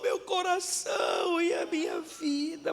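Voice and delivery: slow, pleading, rising to a cry; humble cry for help; sincere plea. Shown as a demonstration. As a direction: beg God honestly. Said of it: "falar assim".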